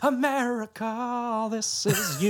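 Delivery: drawn-out announcer voice. Pretend. Mock announcer calling America.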